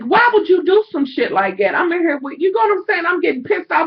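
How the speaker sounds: retold pissed-off complaint; retold anger. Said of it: "I was so mad".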